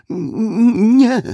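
high, loud cry of denial; frightened denial. A narrator's reading. The cornered suspect answers Westfield's roaring, frightened denial. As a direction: deny it in a high, panicked voice. Suspect denies under roared questioning.

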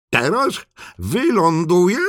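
high-pitched call from above. Acted. Turtle plunging from the sky, calling out high. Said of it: "wołał".

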